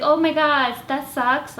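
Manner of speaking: mock sympathy. Vlogger imagining a reply to her roommate, mock sympathy. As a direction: gush insincerely. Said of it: "what am I gonna say".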